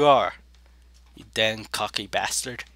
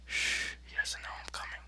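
hushed, voiceless sneaking whisper; conspiratorial whisper; 15 dB quieter than usual. Real, not acted. Player sneaking up on opponent, whispering. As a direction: whisper so the target won't hear.

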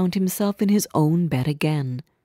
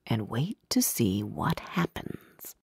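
whispering; 7 semitones lower, 10 dB quieter than usual. A narrator's reading. Narrator hushes suspense.

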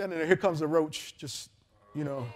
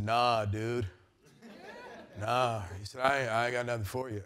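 lazy hippie voice; 7 semitones lower, 7 dB quieter than usual. Retold or imitated.

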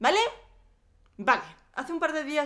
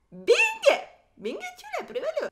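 very high falsetto goading; squeaky taunt; 5 dB louder than usual. Pretend. Vlogger challenging viewers to try it, squeaky falsetto taunt. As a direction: dare them in a tiny squeaky voice.

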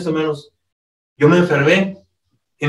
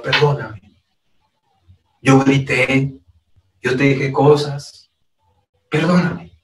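tender apology; 4 semitones lower than usual. Pretend. Husband apologizing to his wife.

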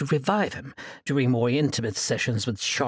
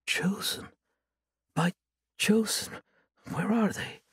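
faint murmur; 7 semitones higher than usual. Acted. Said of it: "murmured through cracked lips".

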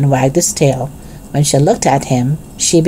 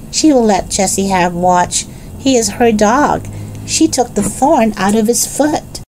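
put-on higher-pitched child figure making a case; a hint of little Benny's higher voice; lightly voiced young Benny; 6 semitones higher than usual.

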